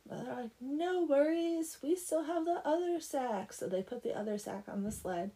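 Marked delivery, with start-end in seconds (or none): 0.6-3.5 higher, drawn-out "no worries"; breezy reassurance. Acted. Girls reassuring each other about the sacks, breezy. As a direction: brush it off in a higher, slower voice. Girls shrug off lost sack.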